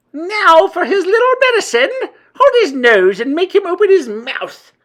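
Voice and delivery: high, loud, shrieking villain voice; gloating witch. A narrator's reading. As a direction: shriek orders with wicked relish. Grand High Witch gloats over captive.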